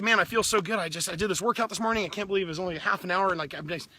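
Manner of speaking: like you just crushed a morning workout; acting out a buzzing greeting after a workout; pumped-up greeting. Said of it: "or you say".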